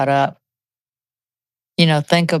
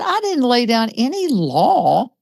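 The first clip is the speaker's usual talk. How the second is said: drawn-out, higher-pitched denial; retold protest; 6 semitones higher than usual. Wife denying to her husband that she was bossy, retold protest. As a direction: protest it slowly, pitch lifted.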